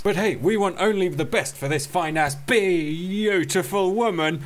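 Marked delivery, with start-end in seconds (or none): 2.3-4.4 very slow lovestruck words; slow swooning drawl. Pretend. Cook dreaming of his date, very slow. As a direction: stretch it out dreamily.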